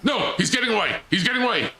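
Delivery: high-pitched panicked cries; alarmed calling out. Real, not acted. Player as the robot gets away, alarmed. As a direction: call after the runaway in high alarm.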